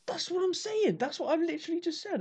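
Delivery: very high-pitched exasperated protest. Real, not acted. Skit teller to interrupting co-host, high-pitched exasperation. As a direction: insist in an indignant high voice. Exasperated at being told his own point.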